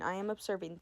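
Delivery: ordinary delivery, nothing marked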